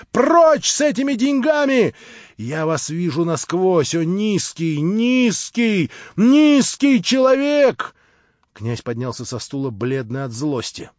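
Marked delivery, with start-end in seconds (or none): 0.1-7.9 high, loud, slowing contempt building to the last word; enraged scream. A narrator's reading. Natasha driving the prince out with his money, screaming. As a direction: spit the insult, louder each time. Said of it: "закричала Наташа".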